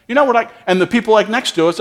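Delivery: ordinary talk, nothing marked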